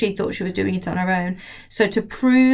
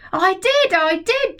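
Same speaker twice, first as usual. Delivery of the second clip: put-on higher, eager boy's cry; boy shouting; 11 semitones higher, slower than usual. George shouting up at Grandma, insisting. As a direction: insist like a boy who won't be doubted. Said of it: "George shouted".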